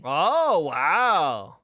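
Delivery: loud, delighted gasp of wow; genuinely impressed at the art; surprised. Real, not acted. Reacting to the character art.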